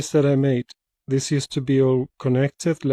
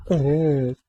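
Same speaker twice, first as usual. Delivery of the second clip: usual speaking voice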